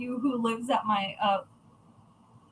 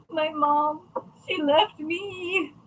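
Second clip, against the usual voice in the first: a slow, higher mock whimper of a crying little boy; imitating sobbing child; 4 semitones higher, slower than usual. Little nephew crying to aunt about his mom, imitated sob. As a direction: retell the nephew's slow, weepy complaint. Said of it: "And he was just sobbing".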